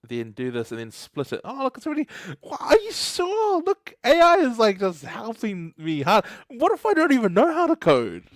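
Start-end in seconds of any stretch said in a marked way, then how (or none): 1.4-6.2 high, excited amazement at the screen; amazed squeal. Real, not acted. Excited at AI autocompleting code. 6.5-8.2 excited joke. Real, not acted. Joking about AI writing code.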